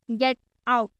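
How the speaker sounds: curt, dismissive "get out" as a command; rude get out. Shown as a demonstration. Teacher showing students the rude way to say it, curt and dismissive. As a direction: say "get out" like showing someone the door. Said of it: "get out नहीं बोलेंगे".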